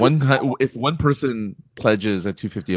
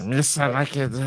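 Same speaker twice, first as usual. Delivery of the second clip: put-on halting French-accented goofy creature voice; stumbling, put-on French cartoon alien; Jar Jar Binks; 3 semitones higher, 4 dB quieter than usual. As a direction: do a badly faked French version of the goofy alien. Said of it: "You did a French Jar Jar Binks".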